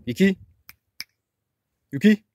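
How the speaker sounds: loud, rising call of a name; calling the dog. Real, not acted. Owner calling the dog to come over, loud rising call. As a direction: call her over across the garden.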